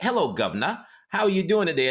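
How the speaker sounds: high, hammed-up English greeting; mock English accent. Woman in skit parodying the English, mock English accent. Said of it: "she sounded like she was English".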